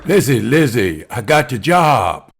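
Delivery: loud, joyful calling out of the name; excited shout. Acted. Husband bursting through the door, shouting with joy. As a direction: burst in shouting the good news.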